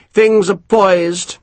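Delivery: lightly voiced self-satisfied officer; a hint of a smug English colonel; pompous colonel. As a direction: savour each word, pleased with yourself. Colonel deliberating.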